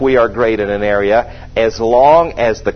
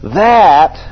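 preaching at full force; 5 semitones higher, slower than usual. Acted. Preaching rise on scripture.

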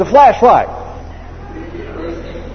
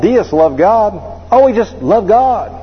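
usual speaking voice

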